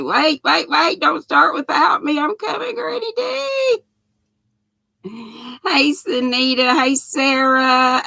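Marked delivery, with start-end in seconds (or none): 0.0-3.8 acted-out latecomer, climbing higher and higher; playful plea rising to a high call; calling to wait. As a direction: call out as if running to catch up. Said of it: "Dee said".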